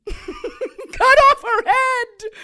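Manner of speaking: slow, high laughing delight; laughing with glee. Acted. Kay gleefully recalling a murder, slow high laughing. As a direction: giggle through the gore.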